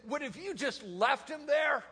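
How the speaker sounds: high-pitched, incredulous challenge; mock appalled wife. Wife's appalled challenge, retold.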